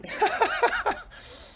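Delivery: squealing high laugh; high giggle. Real, not acted. Laughing at own playful demo.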